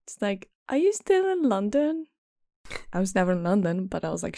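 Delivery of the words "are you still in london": high nasal put-on question; mock high-pitched girl voice; imitating nasal friend. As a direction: mimic an annoying old schoolmate, pitched up. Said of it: "She talks like this".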